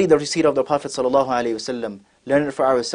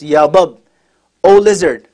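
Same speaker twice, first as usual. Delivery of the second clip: loud, raised summons; calling out; 3 semitones higher, 7 dB louder, slower than usual. Retold or imitated. Prophet summons the dead lizard, calling out. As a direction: call firmly to a creature to wake it.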